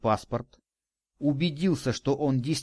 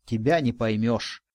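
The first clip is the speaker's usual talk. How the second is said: ordinary delivery, nothing marked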